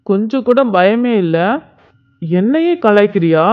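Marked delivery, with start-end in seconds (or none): none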